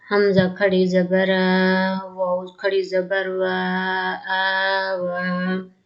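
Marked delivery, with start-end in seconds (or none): none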